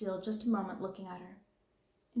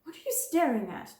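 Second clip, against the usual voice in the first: higher, louder, snapped question; sharp demand; 6 semitones higher, 4 dB louder than usual. Acted. Sharp angry demand. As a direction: headmistress to the staring girl, sharp and loud. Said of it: "demanded Miss Minchin sharply".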